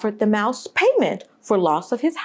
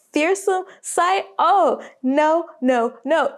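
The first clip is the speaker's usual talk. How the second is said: higher and slow on "fearsome sight"; drawn-out high refrain; 6 semitones higher, slower than usual. Acted. Repeated refrain, rising intensity.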